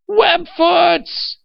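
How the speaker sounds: high, full-voiced wail of outrage; wailed scream. Old man spots the aliens in the park, wailing in rage. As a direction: scream it out at the top of your lungs. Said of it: "he wailed".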